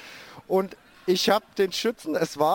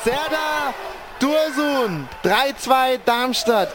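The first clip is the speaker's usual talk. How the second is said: excited goal call; 3 semitones higher than usual. Real, not acted.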